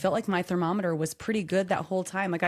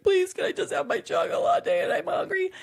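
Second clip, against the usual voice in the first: high-pitched whiny kid imitation; child freaking out; 12 semitones higher than usual. Kids begging mom at bedtime, mock freaking out. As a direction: plead like a frantic little child, pitched way up. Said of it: "freaking out".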